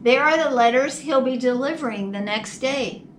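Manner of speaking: ordinary delivery, nothing marked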